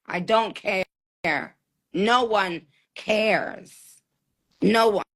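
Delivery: very slow, drawn-out, deliberate indifference; slow dismissal. Real, not acted. Host tells guest she does not care, slow dismissal. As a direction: stretch each word out to shut it down.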